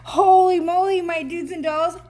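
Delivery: loud, high holy moly; amazed exclaim. Real, not acted. Reader amazed at the card, loud and high. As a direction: gasp it out in delight.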